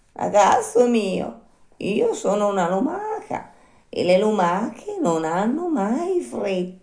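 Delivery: high, very slow, placid snail; unhurried snail. Acted. Snail refusing to hurry for the puppet, slow placid snail voice. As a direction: draw it out with total phlegm. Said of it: "tutta pace e tutta flemma".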